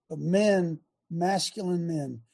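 ordinary talk

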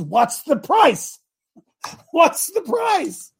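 drawn-out, high preacher's punchline; emphatic rhetorical question. Real, not acted. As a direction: drive home PRICE, stretched out.

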